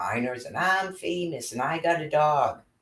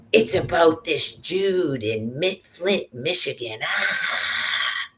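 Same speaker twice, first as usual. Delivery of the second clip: high, sneering mock voice, higher throughout; mocking imitation; 6 semitones higher, 3 dB louder than usual. Mocking baby Veronica's made-up excuses in a high taunting voice. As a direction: ham up her excuses in a taunting falsetto.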